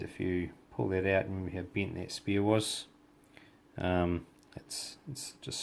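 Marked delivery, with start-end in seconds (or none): 4.6-5.6 soft surprised whisper; hushed, half to himself. Real, not acted. Quietly pleased at the result.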